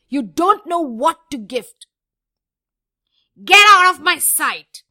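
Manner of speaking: furious lion roar, high and loud; roaring in anger. Acted. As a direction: bellow it like an enraged king. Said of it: "roared in anger".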